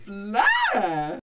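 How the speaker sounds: surprised. Acted. Mock scandalised at guest.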